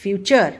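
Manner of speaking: future with the t as च, fyoo-cher; chur sound. Shown as a demonstration. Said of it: "this is not".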